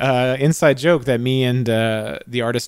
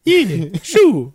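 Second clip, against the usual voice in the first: much higher mock Brooklyn yes; Brooklyn accent; 6 semitones higher, 4 dB louder than usual. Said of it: "they both have Brooklyn accents".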